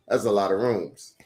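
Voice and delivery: ordinary delivery, nothing marked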